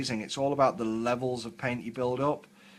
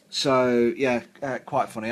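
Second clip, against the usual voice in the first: normal talking voice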